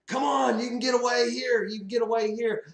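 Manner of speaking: high, loud urgent holler; urgent call. Acted. Tim Allen urging the dreamer into the getaway bus, urgent holler. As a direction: call them over to escape, high and loud.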